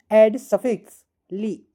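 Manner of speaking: very slow, word-by-word reading of the instruction; slow dictation. Shown as a demonstration. Teacher reading the exercise instruction slowly to students. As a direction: spell it out slowly for the class.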